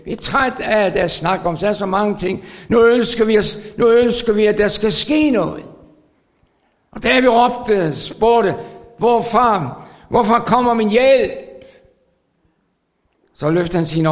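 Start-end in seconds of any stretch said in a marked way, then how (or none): 2.7-11.3 louder, higher urgent plea; pleading sermon voice; fervent preaching. Acted. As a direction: cry out for help from the pulpit. Impassioned preaching.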